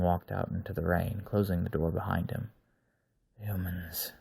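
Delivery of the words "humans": a soft breathy whisper. Acted. Wolf man whispers to himself walking into rain. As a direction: savor the word under his breath. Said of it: "he whispered".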